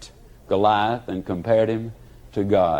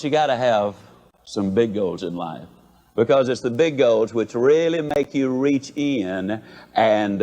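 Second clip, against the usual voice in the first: ordinary talk, nothing marked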